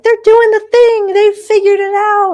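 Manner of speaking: high, loud mock gush of admiring followers; mocking onlooker voice. Host mimicking social media followers, high gushing mock voice. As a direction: squeal it like an impressed fan online. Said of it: "People just see us on social media being like".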